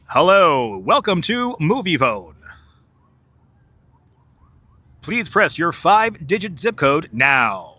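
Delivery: slow, drawn-out hotline greeting; Movie Phone announcer. Acted. Movie Phone welcoming a caller, hammed-up slow announcer. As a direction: announce it slowly with exaggerated swoops.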